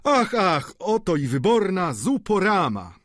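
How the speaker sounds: high, exuberant exclamation; delighted cry. A narrator's reading. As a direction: announce the soup with comic glee. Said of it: "zawołał Poiret".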